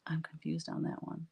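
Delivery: soft unvoiced whisper; whispered aside. Real, not acted. Puzzling over a viewer's comment, whispering. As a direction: whisper it as if to yourself.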